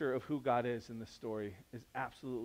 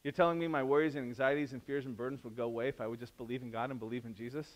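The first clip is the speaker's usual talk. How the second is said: ordinary delivery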